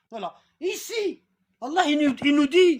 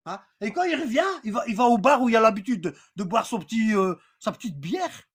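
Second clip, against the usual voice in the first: ordinary talk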